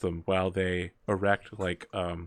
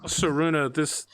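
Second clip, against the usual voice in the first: ordinary talk, nothing marked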